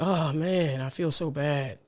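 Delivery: low, soft, sorry-for-yourself groan; mock-miserable sick person's complaint; acted sick moaning. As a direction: act out feeling poorly, voice sinking and dragging. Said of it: "when you say it like that".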